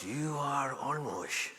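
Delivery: put-on a quiet elderly stranger, very slow; old man. Old man in the restroom hall names Olmos, slow and soft. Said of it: "He goes".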